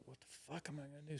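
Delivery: a fast hushed mutter, panicking; panicked whisper. Retold or imitated. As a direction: rush it under your breath. Retold panic in bathroom.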